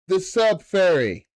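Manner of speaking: loud, slow, high announcement of the Sub Fairy; drawn-out loud herald call; grand announcement. Acted. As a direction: boom it out like a fanfare.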